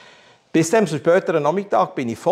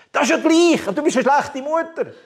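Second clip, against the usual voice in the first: mock furious outburst; 11 semitones higher than usual. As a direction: blurt it out like a defiant teenager. Retold outburst at his mother. (wütend und viel höher geschrien)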